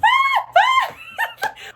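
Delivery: high shouted sigh of relief; loud relieved sigh. Real, not acted. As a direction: let out a huge sigh. Loud sigh after interview.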